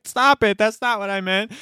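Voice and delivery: high-pitched mock protest; imitating wife. Husband retells wife objecting to his teasing, high mock protest. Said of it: "She's like".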